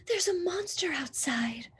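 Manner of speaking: high, soft scared whisper; frightened whisper. Acted. Baby whispering to her sister about the monster. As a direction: whisper it fearfully. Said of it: "said Baby".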